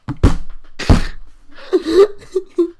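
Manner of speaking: high, breathless cackle; helpless laugh. Real, not acted. Host cracking up at own spring joke, helpless laugh. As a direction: collapse into real laughter.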